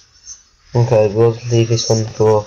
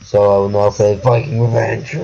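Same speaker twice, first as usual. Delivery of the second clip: ordinary delivery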